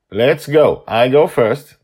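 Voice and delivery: loud, firm order to move; commanding leader. A narrator's reading. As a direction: take charge of the team. Justin leads the group.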